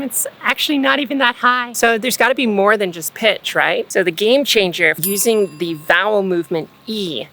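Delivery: ordinary delivery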